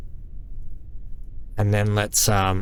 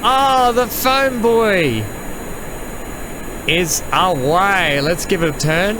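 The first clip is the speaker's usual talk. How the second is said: excited; 7 semitones higher, slower than usual. Real, not acted. Launch thrill.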